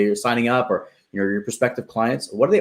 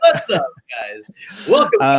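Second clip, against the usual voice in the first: annoying YouTuber voice. Shown as a demonstration. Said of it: "without having the annoying YouTuber voice".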